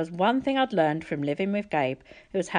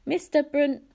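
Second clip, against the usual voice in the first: urgent pleading; 8 semitones higher than usual. A narrator's reading. Percy pleading, confused.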